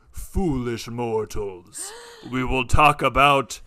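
slow booming dark lord; loud, drawn-out villain proclamation. Pretend. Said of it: "All right, now".

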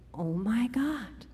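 breathless slow whisper; awed whisper. Acted. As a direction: whisper it, stunned. Stunned reaction at the stadium.

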